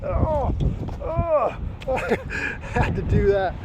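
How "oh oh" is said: high, drawn-out mock cry of pain; imitated cramp groans. Cyclist with a Charlie horse pushing pedals, mock groans. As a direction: act out a sudden leg cramp. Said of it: "I'd get a Charlie horse and be like".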